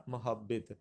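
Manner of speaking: محبت as "ma-hab-bit", a first, i last; pronunciation option. Shown as a demonstration. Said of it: "غلط پڑھا جاتا ہے".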